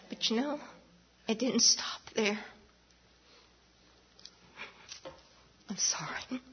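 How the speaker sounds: hushed, voice sinking to a whisper; soft and halting, struggling to get the words out; real choked-up emotion. Real, not acted. Choking up in testimony, apologizes.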